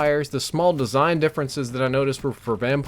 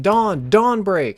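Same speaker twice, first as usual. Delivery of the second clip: drawn-out, high mock yell; imitated cry of alarm, held back from full volume; retold scream; 6 semitones higher, 4 dB louder, much slower than usual. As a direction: call out a warning, stretched and raised. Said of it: "I hear somebody scream".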